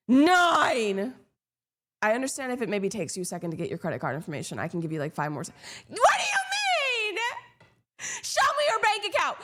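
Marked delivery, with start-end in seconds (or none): 0.1-1.1 louder, higher number barked out; pressuring countdown call; mock countdown yell. 5.9-9.4 very high shrill shriek, slow; hammed-up hysterical demand; mock screaming demand.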